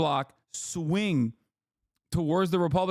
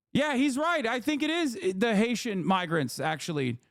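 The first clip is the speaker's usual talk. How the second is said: mock convinced voter; 6 semitones higher than usual. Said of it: "voter agreeing with other guy".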